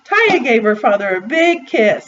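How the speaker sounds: ordinary delivery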